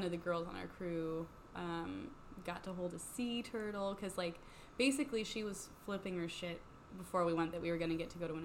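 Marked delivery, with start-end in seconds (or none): none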